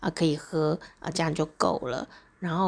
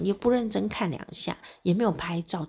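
ordinary talk, nothing marked

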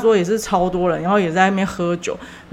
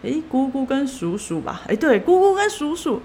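relative correcting; 9 semitones higher than usual. Retold or imitated. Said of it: "中国亲戚就说".

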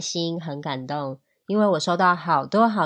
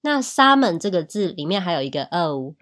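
usual speaking voice

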